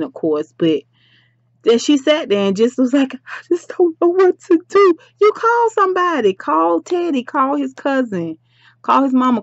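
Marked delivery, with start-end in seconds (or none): 3.1-4.9 high-pitched helpless whine; mock someone at a total loss; mimicked helplessness. Vlogger mimics Duchess sitting there, helpless whine. 5.2-9.4 high-pitched, insistent list; exasperated advice. Pretend. Vlogger tells absent Duchess whom to call, exasperated.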